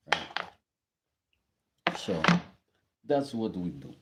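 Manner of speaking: hammed-up Russian accent; joking, overdone Russian accent; fake Russian accent. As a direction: put on a mock Russian accent. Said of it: "Sorry for the fake Russian accent".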